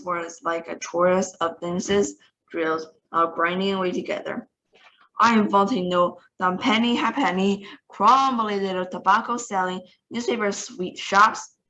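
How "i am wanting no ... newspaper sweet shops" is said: usual speaking voice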